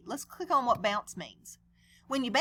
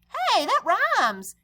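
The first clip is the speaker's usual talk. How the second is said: high, slow, louder exclamation; delighted cry; 10 semitones higher, 6 dB louder, much slower than usual. Acted. Clark discovering his rule rhymes, high and delighted. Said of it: "he cried".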